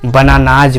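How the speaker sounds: बनानाज ending in a voiced Z/J buzz; बनानाज with the plural S voiced as J; final Z sound. Shown as a demonstration. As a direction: end बनानाज on Z, not S. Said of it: "क्या बोलेंगे".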